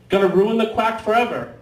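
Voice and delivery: ordinary delivery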